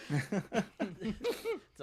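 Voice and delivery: real laugh through the words. Real, not acted. Laughing off being turned down by Miguelito. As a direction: chuckle it out, slow and amused.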